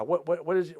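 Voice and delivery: normal talking voice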